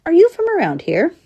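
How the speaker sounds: high-pitched questioning call; high, inquiring hail. A narrator's reading. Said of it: "he called out".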